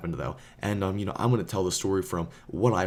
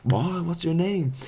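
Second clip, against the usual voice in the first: mock bully; 9 semitones higher, slower than usual. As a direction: press him for his name. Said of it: "and he's like".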